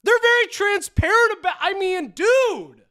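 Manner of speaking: incredulous repeat. Real, not acted. Repeating the YouTuber's claim in high-pitched disbelief. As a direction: echo it back in disbelief.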